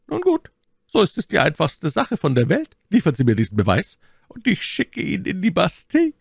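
high-pitched theatrical statesman; stern cardinal. A narrator's reading.

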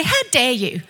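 high, fed-up protest; indignant and resolute; defiant declaration. Acted. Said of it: "you need to rise up and say".

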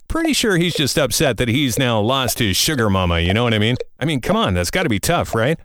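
ordinary talk

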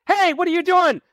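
high chirpy Dutch Brothers server greeting a customer; perky barista. Retold or imitated. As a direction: gush like an over-eager coffee barista. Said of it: "they're like".

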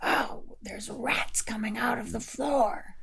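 high, frightened squeal of passengers; panicked passengers. Acted. Passengers squeal in panic over a rat, high and frightened. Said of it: "they all started screaming".

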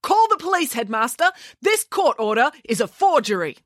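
put-on high, emphatic girl's alarm; ringing, indignant declaration; urgent accusation. A narrator's reading. Accuses him before the headmaster.

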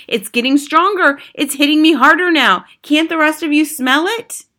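high, loud and excited; urgent witch. A narrator's reading. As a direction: press the others urgently. Witch urgently reporting the smell.

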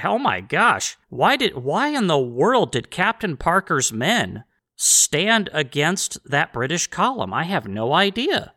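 slow, drawn-out sarcastic puzzlement; mock bewilderment. Host mocks history professors' confusion, exaggerated puzzlement. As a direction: feign total bafflement, stretching the words.

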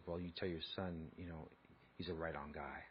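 very soft, low confidential answer; quiet reply. Retold or imitated. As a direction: answer under your breath, keeping it secret.

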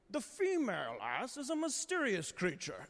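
put-on British documentary narrator accent, drily solemn; deadpan British nature-film narration; David Attenborough British accent. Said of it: "he goes".

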